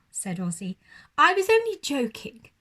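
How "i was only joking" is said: high, loud, cheeky owl voice; gleeful prankster owl. Acted.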